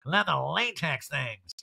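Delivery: slow put-on drawl; loose, hammed-up impression; Erica. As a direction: drag out the vowels, slightly high. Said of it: "she's, like, man spreading and like".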